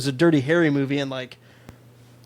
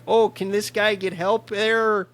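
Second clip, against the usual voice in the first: put-on thick Chicago accent, higher and slow; 6 semitones higher, slower than usual. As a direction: play a Chicago phone operator with a thick Chicago accent. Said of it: "such a thick Chicago accent".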